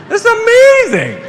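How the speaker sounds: real laughing amazement; laughing delight. Real, not acted. Delighted by people online.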